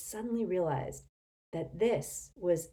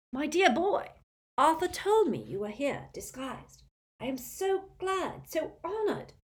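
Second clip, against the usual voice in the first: put-on much higher, fluttering old man; high excited old wizard; high wheezy old wizard; 10 semitones higher than usual. A narrator's reading. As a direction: gush with nervous delight. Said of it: "high-pitched, wheezy voice".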